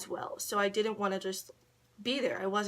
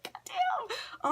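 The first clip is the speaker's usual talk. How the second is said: whispered aside. Retold or imitated. Muttered reaction to clingy girl.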